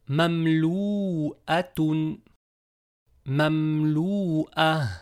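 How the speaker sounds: Mamlouatun drawn out, very slow; slow blended word. Shown as a demonstration. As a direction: stretch Mamlouatun out evenly. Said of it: "slow blended reading". (lecture très lente de Mamlouatun)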